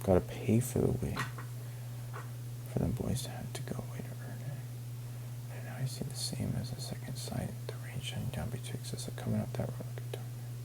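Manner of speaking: whisper. Shown as a demonstration. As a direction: breathe the reread line as a hushed whisper. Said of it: "rereads the same passage whispered".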